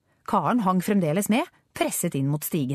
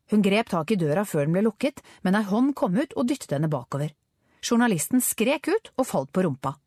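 ordinary delivery

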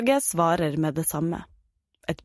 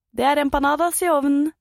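higher, louder mum calling from behind; mother's voice; 8 semitones higher, 4 dB louder than usual. Acted. Mum surprises her son from behind, lightly voiced mother. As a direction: brightly announce dinner as the mum.

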